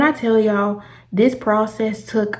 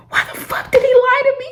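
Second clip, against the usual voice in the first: crying outburst. Retold or imitated. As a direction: break into a high crying protest. Retells breaking down at gate.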